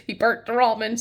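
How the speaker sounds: normal talking voice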